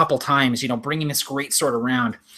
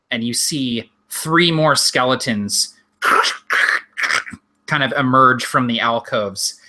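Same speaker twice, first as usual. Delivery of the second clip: normal talking voice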